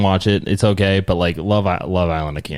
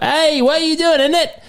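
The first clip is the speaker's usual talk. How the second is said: very high caricature of a British contestant; hammed-up, high-pitched fake British; mock British accent. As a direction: squeal it in a silly British accent. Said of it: "my interpretation of, uh, British".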